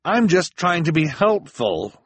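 high, loud, defensive cry; protest, stung; hurt cry. Acted. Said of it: "Klaus cried".

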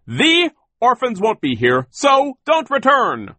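high, loud holler, drawn out at the end; yelling after someone. A narrator's reading. Sir warns the runaways off, yelling high and loud. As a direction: yell the warning across a distance. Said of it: "Sir yelled out after them".

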